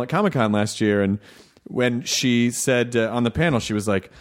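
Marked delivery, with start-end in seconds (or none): none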